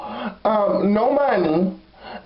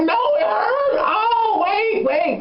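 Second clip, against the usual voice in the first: fake pain; 12 semitones higher, much slower than usual. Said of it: "I was like".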